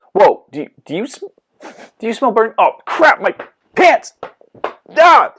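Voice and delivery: high, rising panic as pants burn; frantic, climbing to a loud yelp; panicked yelps. Acted. Host notices his pants burning, panicked high yelp. Host's pants catch fire.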